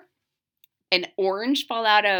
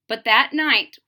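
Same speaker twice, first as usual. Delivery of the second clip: plain reading voice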